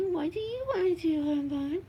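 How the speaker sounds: very high cutesy cooing. Real, not acted. Asking honey bunny what to do, high baby talk. Cooing the question again, higher.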